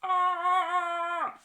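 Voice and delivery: loud, high mock scream; wail. Storyteller reacts to fish order, wailing. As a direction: wail in comic despair. Troll dismayed by fish again.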